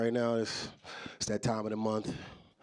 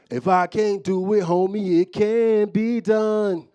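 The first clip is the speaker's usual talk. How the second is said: loud, high, drawn-out rapper imitation; hammed-up rapper doctor voice; 8 semitones higher, 11 dB louder, slower than usual. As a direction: boast it loudly, stretching the last words slow. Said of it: "he start to sound like him".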